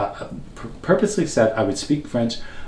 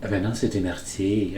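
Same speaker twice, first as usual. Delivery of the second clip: French speakers; 4 dB quieter than usual. Retold or imitated. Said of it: "when I went to France".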